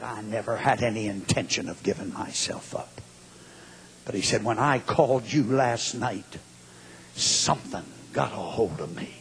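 very low, soft reply; hushed confession. Retold or imitated. Killer explaining to the attorney why he confessed, hushed.

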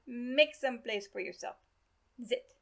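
put-on old doctor's German-accented order; thick accent. A narrator's reading. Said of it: "in his thick accent".

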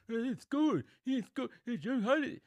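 high-pitched put-on voice of complainers; hammed-up mock complaint in a high voice; mocking critics. Mocking people obsessed with scoring.

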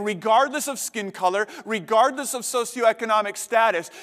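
normal talking voice

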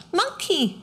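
put-on high, babyish little-girl voice; small toddler voice. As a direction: coo it like a tiny child. Little Maudie voiced by reader.

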